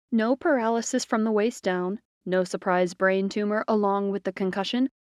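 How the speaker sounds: ordinary delivery, nothing marked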